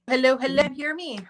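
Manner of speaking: ordinary talk, nothing marked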